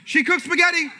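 ordinary delivery

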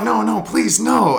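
high, loud comic begging; retold panicky protest; mock pleading. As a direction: beg in hammed-up dread. Retold pleading at teammate showing up.

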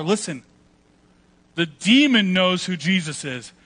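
ordinary talk, nothing marked

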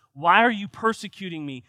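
calling out. Acted. Jesus calling out to Saul on the road, loud. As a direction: call across the road.

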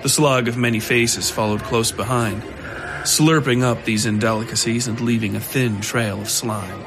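normal talking voice

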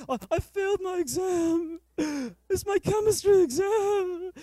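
high, slow hammed-up wail; mock sobbing teen. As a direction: wail it like a kid who failed. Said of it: "And he's crying".

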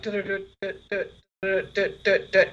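soft, rhythmic "dut" knocks; imitated knocking. Gambler doing a secret code knock, imitated politely. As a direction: tap out a secret code with the voice, quietly. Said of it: "trying to do secret code".

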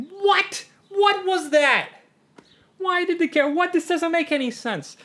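very high, outraged mock yell; yelling at screen. Movie watcher ranting at the screen, high mock-outraged yell. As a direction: screech in disbelief at a bad film. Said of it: "ends up yelling at the screen".

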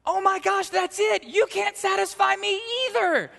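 high, loud delighted shout of discovery; joyful yell. Retold or imitated. Wife telling her husband the answer in a restaurant, joyful loud yell. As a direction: shout it out like a sudden happy revelation. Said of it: "and she yelled out".

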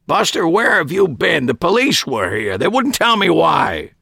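a hint of an anxious uncle; worried old uncle. A narrator's reading. Harry voiced in story.

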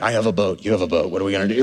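low, flat parody of a bragging neighbor; mock boastful neighbor. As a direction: lampoon the keeping-up crowd. Mocking competing boat owners.

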